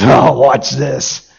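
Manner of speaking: put-on low, slow cocky teen voice; a smug show-off kid drawling it out. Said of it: "he looks at me and he goes".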